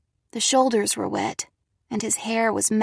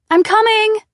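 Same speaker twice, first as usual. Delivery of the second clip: high, loud shout upstairs; calling out; 12 semitones higher, 9 dB louder than usual. Acted. Girl answers Harry from the stairs, loud call. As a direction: call up to a frightened child. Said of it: "I called".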